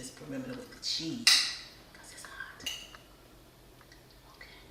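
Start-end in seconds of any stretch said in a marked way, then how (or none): 1.9-4.6 soft whispered aside; whispering. Real, not acted. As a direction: drop to a bare whisper. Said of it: "Every time I start whispering".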